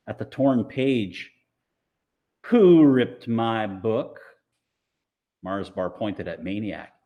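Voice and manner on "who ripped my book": slow, loud demand; each word a warning. Acted. Amanda confronts the bullies about her book, slow loud demand.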